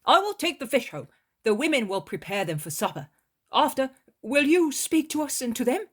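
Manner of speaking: high, loud voice of a cheerful fisherman; Peter, cheerful fisherman. A narrator's reading. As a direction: Peter heading home with the fish, glad.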